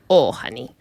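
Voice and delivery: low, soft take on the line; low and quiet, in character; character voice attempt. Shown as a demonstration. Voice exercise, trying the line in character.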